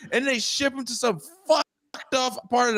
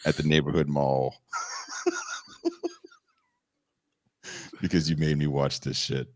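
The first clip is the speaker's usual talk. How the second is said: much lower, grumbling mock rant; Dan's imagined tweet after the show, much lower mock grumble; angry tweet voice; 5 dB quieter than usual. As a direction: drop deep and grumble the complaint. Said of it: "send a tweet out like".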